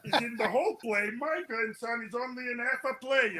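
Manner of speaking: half-laughing put-on grandma griping about the price; grandma's complaint. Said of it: "my booby jumps in and goes".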